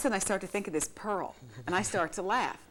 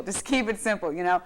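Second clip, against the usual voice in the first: ordinary talk, nothing marked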